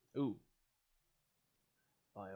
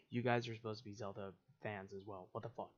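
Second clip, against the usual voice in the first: normal talking voice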